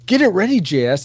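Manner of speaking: high, loud eager call. Retold or imitated. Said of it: "for me saying".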